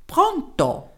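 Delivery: put-on Pronto? in a high little German accent; a tiny German professor's Pronto?; small German-accented voice. Professor answering the mayor's call, small German-accented voice. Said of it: "Una vocina dal tipico accento tedesco".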